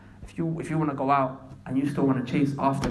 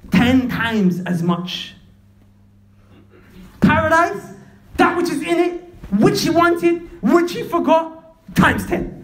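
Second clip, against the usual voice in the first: loud, high and drawn-out for emphasis; slow, ringing exclamations; impassioned preaching; 6 dB louder, much slower than usual. Real, not acted. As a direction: hammer each phrase at the congregation. Preaches at full force.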